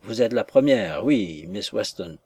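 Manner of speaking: ordinary delivery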